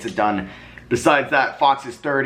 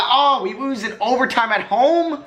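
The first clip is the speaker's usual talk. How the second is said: high, slow, stunned question; can't believe they lost at home; shocked disbelief; 11 semitones higher, slower than usual. Real, not acted. Losing in overtime, disbelief.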